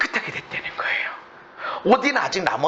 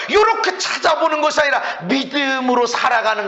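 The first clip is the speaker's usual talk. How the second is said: high, emphatic sermon delivery; full-force preaching; 10 semitones higher, 4 dB louder than usual. Real, not acted. Preacher warning believers against being deceived, full-force preaching. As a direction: drive the warning home at raised pitch.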